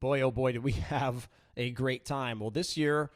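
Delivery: ordinary talk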